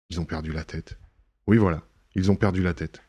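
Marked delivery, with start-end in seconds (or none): 0.1-1.0 softer, murmured to himself; half to himself, much softer; quiet aside. Acted. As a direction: drop it low as a private thought. Lupin musing aloud to himself.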